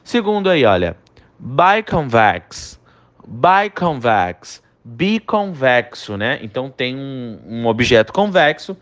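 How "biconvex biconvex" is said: biconvex said very slowly; slow English pronunciation. Shown as a demonstration. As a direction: model biconvex for repetition. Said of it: "você vai repetir a pronúncia". (biconvex bem devagar em inglês)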